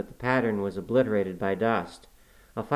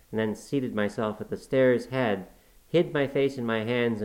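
usual speaking voice

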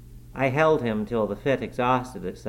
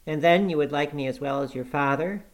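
cheerful; 6 semitones higher, 4 dB louder than usual. A narrator's reading. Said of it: "observed he more cheerfully".

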